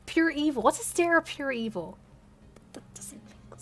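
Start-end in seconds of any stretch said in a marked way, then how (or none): 2.7-3.6 barely voiced complaint to herself; baffled whisper. Real, not acted. Muttered complaint about her story.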